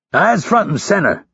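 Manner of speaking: high-pitched drill instructor command; snapped order. A narrator's reading. Instructor orders recruit to face him, snapping. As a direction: snap him to attention. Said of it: "Hurley snapped".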